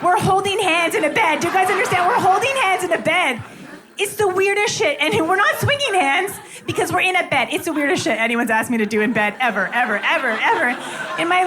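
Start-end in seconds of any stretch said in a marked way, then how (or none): 0.0-7.5 high, disbelieving hand-holding outrage; mock outrage, voice up high; incredulous. Real, not acted. Telling the crowd about holding hands in bed, incredulous.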